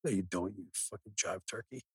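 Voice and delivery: usual speaking voice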